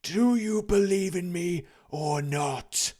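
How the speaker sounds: slow, high, hollow ghostly drawl. A narrator's reading. The dead partner's ghost answering Scrooge, slow and hollow. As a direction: intone it like a wailing spirit.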